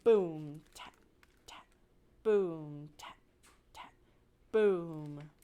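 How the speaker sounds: low, loud boom then light taps; beating the council drum; drum beats. Acted. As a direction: drum it out with your voice. Narrator imitates the drum.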